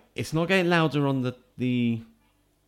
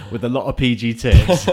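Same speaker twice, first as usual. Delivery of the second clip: laughing; 10 dB louder than usual. Real, not acted. Joke answer while laughing.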